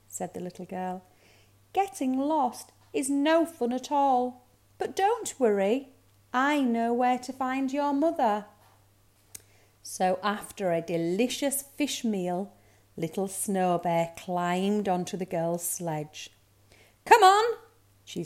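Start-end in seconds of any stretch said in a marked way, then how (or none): 1.7-8.5 put-on higher girlish reassurance; reassuring girl. A narrator's reading. Girl promises to find mother, reassuring. 17.0-17.6 high, loud call to the team. A narrator's reading. Girl urges her sled dogs, calling out.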